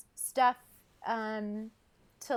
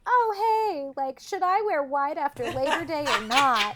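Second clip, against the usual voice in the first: airy light voice; 4 semitones higher, 5 dB louder than usual. Retold or imitated. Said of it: "was something like".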